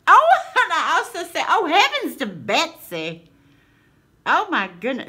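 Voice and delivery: loud, high-pitched exclamation; getting loud. Real, not acted. Delighted by a sign's sayings, getting loud. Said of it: "see, I'm getting loud".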